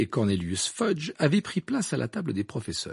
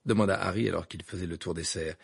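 ordinary delivery

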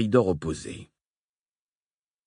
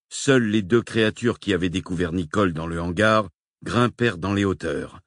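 ordinary delivery, nothing marked

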